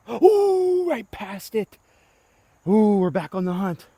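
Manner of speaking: high excited ooh; excited groan. Real, not acted. Disc golfer reacting to a near ace, excited. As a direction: squeal at the near miss.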